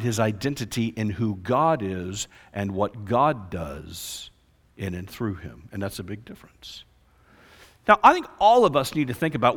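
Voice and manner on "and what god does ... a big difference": hushed, voiceless emphasis; dramatic whisper. Real, not acted. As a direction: lean in and whisper the point. Preacher drops to a whisper.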